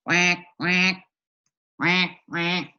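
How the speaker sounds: loud quacking; duck quacks. Retold or imitated. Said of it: "What do they sound like?".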